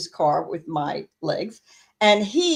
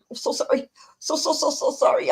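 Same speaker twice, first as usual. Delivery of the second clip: higher-pitched, hurried mock apology; imitated apology; 7 semitones higher than usual. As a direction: pile up the sorries, imitating an apologetic driver. Mimics the apologetic driver.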